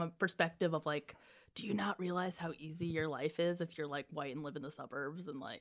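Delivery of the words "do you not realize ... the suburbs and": whisper. Real, not acted. Whispered aside.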